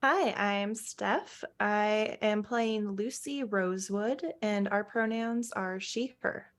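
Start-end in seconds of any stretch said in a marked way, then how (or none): none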